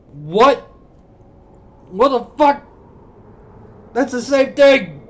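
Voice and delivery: yelling in disbelief at chat; outraged yell. Real, not acted. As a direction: scream it in outrage.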